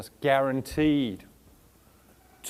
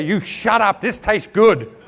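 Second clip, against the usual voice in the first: mock snap; 5 semitones higher, 10 dB louder, much slower than usual. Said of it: "We say".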